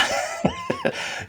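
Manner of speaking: high airy laugh. Real, not acted. Laughing at own story.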